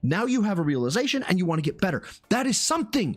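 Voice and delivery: high, emphatic pep talk; earnest encouragement. Retold or imitated. Streamer encouraging a discouraged beginner, high and insistent. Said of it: "I have to convince them that they're".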